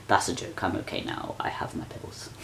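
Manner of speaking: soft whispered joking reassurance; sheepish whisper. Real, not acted. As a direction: whisper it away, half laughing. Dark joke, trailing off.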